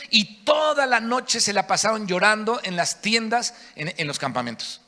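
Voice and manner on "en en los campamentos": ordinary delivery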